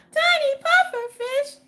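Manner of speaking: high, slow, delighted squeal. Real, not acted. Streamer squealing at a viewer's name. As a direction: squeal the name like spotting something cute.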